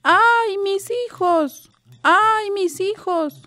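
put-on high, slow, drawn-out wail for her children; a ghostly mother wailing for her drowned children, slow and high; wailing lament. A narrator's reading. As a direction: cry it out long and high like a grieving mother. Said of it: "llorando y diciendo".

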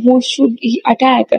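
usual speaking voice

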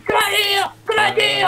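high strained zombie shriek. Retold or imitated. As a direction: shout it like a zombie. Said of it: "the zombies are saying".

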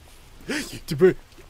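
loud, high gasp of fright; sudden intake of breath in surprise; startled gasp. Real, not acted. Startled in game.

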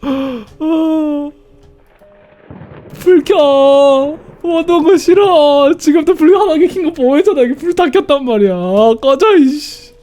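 scared horror game streamer. Real, not acted. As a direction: panicky and disgusted, begging for light.

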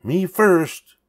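high loud eager cry; cried out. A narrator's reading. Pinkerton racing toward the voice, high loud cry. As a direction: shout as you race ahead. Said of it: "cried Pinkerton".

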